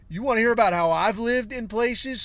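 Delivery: a touch of the grandfather's instant fury; heated. Retold or imitated. Man heatedly challenges his partner about his hard living, retold anger. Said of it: "I'm fucking heated".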